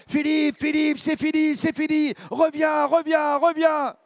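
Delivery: high, loud desperate calls; coach shouting. Retold or imitated. Coach on the boat calling swimmer back, loud. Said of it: "mon entraîneur me crie très, très fort dessus".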